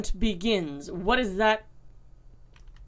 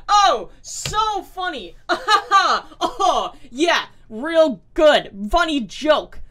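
sarcastic laughter; 6 semitones higher, 6 dB louder, much slower than usual. Real, not acted. Mocking the note's joke.

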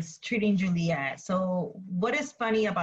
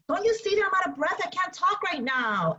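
quick, higher-pitched mock protest; imitating flustered nurse; 9 semitones higher than usual. Teacher paraphrasing the nurse's excuse to Juliet, mock flustered. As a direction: rush it out, pitched up, as the put-upon nurse. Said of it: "and she's like".

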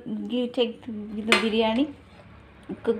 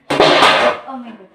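oh my God; 8 dB louder, slower than usual. Real, not acted. Reacting to the cooking.

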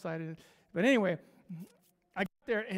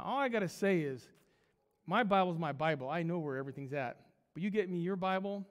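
ordinary talk

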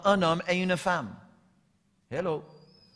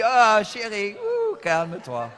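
wife's soothing voice; 2 semitones higher, slower than usual. Retold or imitated. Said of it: "ma femme dit".

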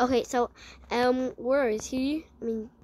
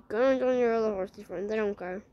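ordinary delivery

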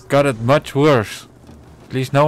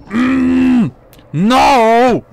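anguished yell; 11 semitones higher, 9 dB louder than usual. Real, not acted. Orc captain escapes again.

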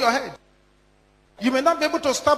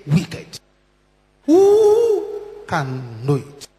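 drawn-out, weighty reading of the verse; slow dramatic scripture reading; 4 semitones lower, 3 dB louder, much slower than usual. Acted. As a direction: let each word land slowly. Dramatic scripture reading over music.

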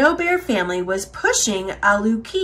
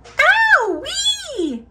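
very high-pitched little bear squeal; baby bear yowl. A narrator's reading. Baby Bear scalded by hot breakfast, yowling. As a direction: yowl like a cub in pain. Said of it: "yowled Baby Bear".

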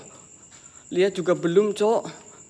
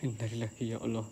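much softer, lower frightened murmur; scared hushed invocation; hushed prayer; 10 semitones lower, 15 dB quieter than usual. Real, not acted. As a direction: breathe it out scared. Frightened after chant.

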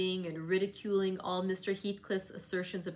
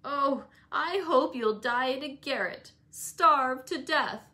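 high, louder, very slow petulant curse; spiteful curse; 6 semitones higher, 7 dB louder, slower than usual. A narrator's reading. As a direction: spit it out at the servant. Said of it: "said the boy, mistaking our approach".

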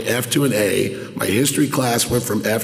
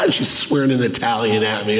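ordinary talk

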